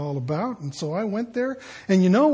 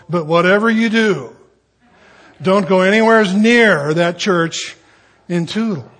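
loud, hammed-up warning; emphatic warning; 2 semitones higher, 8 dB louder than usual. Locals warning a newcomer off a church, loud and emphatic. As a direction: warn them off forcefully, pausing for effect.

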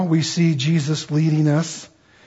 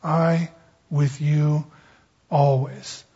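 "I", "with you", "always" slowly set apart by pauses; slow, weighty repetition; 2 semitones lower, 4 dB quieter, much slower than usual. Shown as a demonstration. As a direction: let each phrase sink in, unhurried. Repeats Greek order for effect.

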